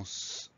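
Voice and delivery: S as a held hiss, not the letter name; isolated consonant sound. Shown as a demonstration. Teacher isolating the second sound in puissance, S as a held hiss. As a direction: let S sustain as a pure "sss". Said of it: "le deuxième son S".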